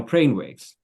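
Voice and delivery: usual speaking voice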